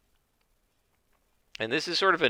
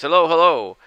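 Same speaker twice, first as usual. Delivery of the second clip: cheery greeting; 7 semitones higher, 11 dB louder than usual. Acted. Harrow greets over the view screen.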